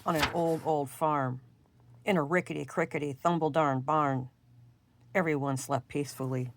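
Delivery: soft, quiet storytelling voice; hushed bedtime reading. A narrator's reading. Reading a bedtime story to children, soft and quiet. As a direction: lull the little ones toward sleep.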